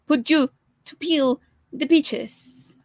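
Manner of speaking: ordinary delivery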